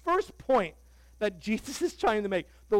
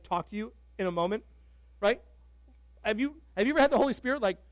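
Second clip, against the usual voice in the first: ordinary delivery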